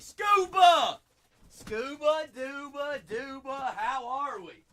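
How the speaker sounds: high, loud call-out greeting a friend; happy shout of welcome. Real, not acted. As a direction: call out a viewer's name with delight. Greeting a viewer loudly.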